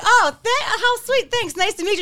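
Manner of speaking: high, sugary thank-you voice; sweet fake politeness. Woman thanking an awkward suitor, high and sugary. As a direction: play it overly sweet and surprised. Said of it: "And I was like".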